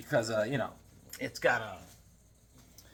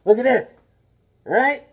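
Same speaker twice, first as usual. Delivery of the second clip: excited reveal; 9 semitones higher, 10 dB louder than usual. Real, not acted. Shows off a mask, excited.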